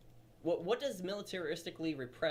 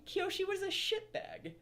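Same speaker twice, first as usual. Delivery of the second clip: mocking; slower than usual. Mocking claim, high slow voice.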